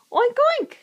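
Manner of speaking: high, loud playful oink oink; loud pig oinks. Shown as a demonstration. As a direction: ham up the pig for the baby. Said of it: "she's looking at the pig".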